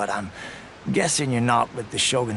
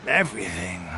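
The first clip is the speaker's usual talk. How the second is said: ordinary talk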